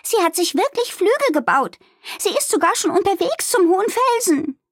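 very high, agitated report; excited cry. A narrator's reading. Fiona reports Amalie heading for the cliff, agitated. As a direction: cry out alarming news. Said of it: "rief Fiona aufgeregt".

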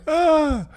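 loud high laugh; high burst of laughter. Real, not acted. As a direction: crack up at the joke.

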